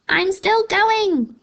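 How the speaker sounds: high, slow screechy crone voice; old screechy voice. A narrator's reading. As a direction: screech it out like a delighted old hag. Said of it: "came the old screechy voice".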